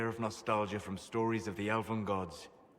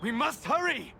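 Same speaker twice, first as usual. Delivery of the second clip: urgent shout; 12 semitones higher, 5 dB louder than usual. Acted. Rushing to save the spirit.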